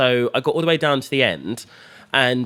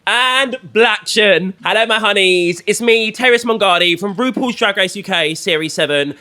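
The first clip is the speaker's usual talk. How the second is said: loud, high, projected presenter voice; hyped talk-show opening; show host intro; 7 semitones higher, 5 dB louder than usual. Acted. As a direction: announce it big, building to the guest's name. Opens show, introduces guest.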